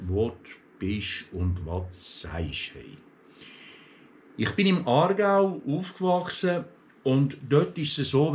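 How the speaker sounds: normal talking voice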